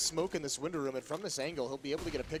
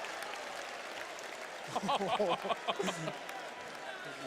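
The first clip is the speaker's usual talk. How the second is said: breathy laugh; 6 semitones higher than usual. Real, not acted. Reacting to the clutch.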